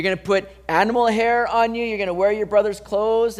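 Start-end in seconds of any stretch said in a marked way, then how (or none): none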